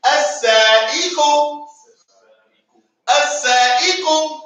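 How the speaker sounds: as-sa'iqu drawn out, each syllable clear; slow model word. Shown as a demonstration. Teacher leads the chorus repeat. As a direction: say as-sa'iqu slowly, every syllable. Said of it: "Everybody after me".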